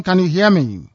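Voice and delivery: usual speaking voice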